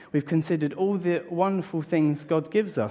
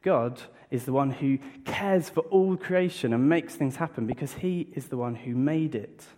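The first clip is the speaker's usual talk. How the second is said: usual speaking voice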